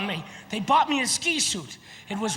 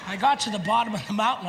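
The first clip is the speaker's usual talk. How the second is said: ordinary talk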